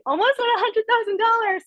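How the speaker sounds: giddy, amazed brag; thrilled. Real, not acted. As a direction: share the big number like you can't believe it. Stressing her near-six-figure earnings.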